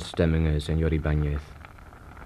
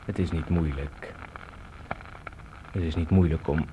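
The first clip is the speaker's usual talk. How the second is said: usual speaking voice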